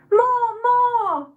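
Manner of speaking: a baying crowd calling out; crowd shout. A narrator's reading. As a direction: egg the ringmaster on from the stands. Crowd calling for more cruelty.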